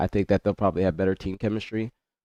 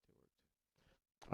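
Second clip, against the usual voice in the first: breathy whisper, far from the mic; 29 dB quieter than usual. Real, not acted. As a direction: whisper it under your breath. Whispered repeat.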